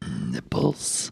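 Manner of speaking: very deep, soft, growled word. Pretend. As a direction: drop into a low rumble. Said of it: "What the fuck was that?".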